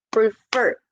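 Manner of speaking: prefer with a strong final fer; teacher's demo of preFER, end syllable stressed; stress on the second syllable. Shown as a demonstration. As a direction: split prefer slightly and land on FER. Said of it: "Fer está más fuerte".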